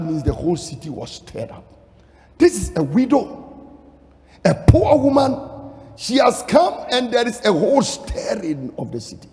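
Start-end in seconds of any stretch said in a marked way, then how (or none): 2.4-9.3 high, loud, rousing sermon delivery; full-force preaching. Acted. Preacher telling the congregation of Naomi's return, full-force preaching.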